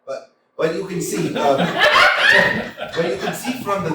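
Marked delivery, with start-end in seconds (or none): 1.6-2.9 high, loud burst of laughter; real laugh. Real, not acted. Actor stumbling over his line and bursting into high laughter. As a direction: crack up completely.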